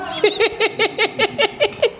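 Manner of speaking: high, helpless burst of laughter; laughing. Real, not acted. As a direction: she breaks up laughing at the memory. Laughing at dad's old phrase. (पापा की बात याद करके खिलखिलाकर हँसना)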